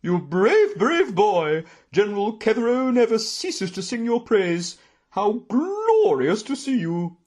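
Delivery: high, loud gushing aunt voice; booming. A narrator's reading. Aunt embracing her returned nephew, booming with delight. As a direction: gush grandly at full volume. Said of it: "she boomed".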